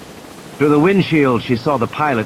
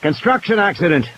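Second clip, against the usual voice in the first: shouted; 7 semitones higher than usual. A narrator's reading. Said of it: "Ed shouted".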